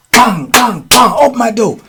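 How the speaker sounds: high, punchy banging and demand; imitated urgent call at a door; acted-out door banging. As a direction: act out pounding to get in. Acting out banging to be let in.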